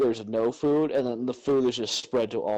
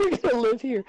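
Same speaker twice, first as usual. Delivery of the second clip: high-pitched mock echo; put-on character voice; 12 semitones higher, 3 dB louder than usual. Echoing friend's movie bit about moving, high put-on voice. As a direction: pitch it well up, playacting.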